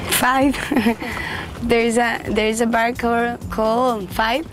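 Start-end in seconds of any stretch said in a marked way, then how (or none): none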